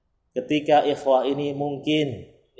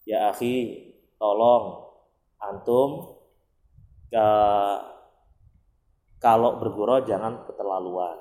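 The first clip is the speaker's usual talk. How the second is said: a calm, polite reading of a chat message, kept low; gentle reading; 5 semitones lower than usual. Shown as a demonstration. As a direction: read it calmly and kindly, like a polite request. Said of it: "Halus kan?".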